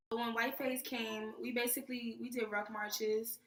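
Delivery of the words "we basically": ordinary delivery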